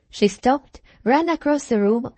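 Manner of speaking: ordinary delivery, nothing marked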